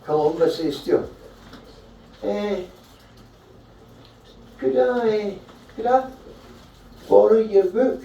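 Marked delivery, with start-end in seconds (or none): 2.2-8.0 timid request. Acted. Columba timidly asking the bishop.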